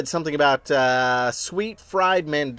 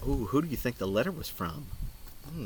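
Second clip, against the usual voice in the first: plain reading voice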